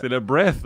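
Breath said with an English th sound; English spelling. Shown as a demonstration. Revealing the real English name behind Bresse, English th. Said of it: "je cherchais Bresse".

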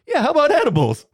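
high, loud, gleeful echo; mock eager echo. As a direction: bounce it back, loud and way up, delighted. Mocking echo of nurse's offer.

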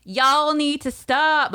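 slow, high mock Southern drawl; badly faked Southern twang; bad Southern accent. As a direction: drag it out like a fake Southerner. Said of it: "sounds like me doing a Southern accent".

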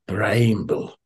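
Bramble tried in a hesitant Scottish accent, vowel as "braymble"; a quick, unsure stab at saying Bramble the Scottish way; tentative Scottish accent. Shown as a demonstration. Host tries the old Scottish label name aloud, unsure Scottish accent. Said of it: "Don't know how you say it with a Scottish accent".

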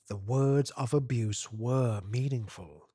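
usual speaking voice

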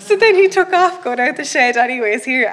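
usual speaking voice

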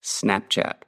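"Snapchat" said in American English, snap-chat; American accent. Shown as a demonstration. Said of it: "Eu vou falar com o sotaque americano".

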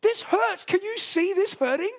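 high, pained pleading; anguished cry. Retold or imitated. A grieving believer to God, high pained plea. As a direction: cry out to God in the middle of the hurt. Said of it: "you're like".

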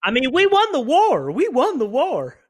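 high, emphatic protest; excited insistence. Acted. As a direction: insist proudly, pitch soaring. Indignant boast about winning the war.

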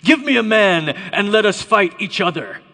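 loud challenge in a put-on accent; Goliath's accented bellow. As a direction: call out an opponent like a champion. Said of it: "Then the Philistine said".

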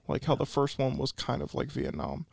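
ordinary talk, nothing marked

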